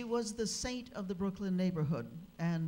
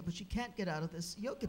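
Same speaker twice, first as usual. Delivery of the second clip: ordinary delivery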